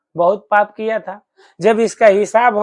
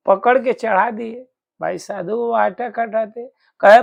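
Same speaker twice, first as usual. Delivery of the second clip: usual speaking voice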